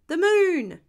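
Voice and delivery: loud, high group cheer in one voice; high, excited storybook shout; children's shout. A narrator's reading. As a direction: shout it out like an eager class. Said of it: "shouted all the children".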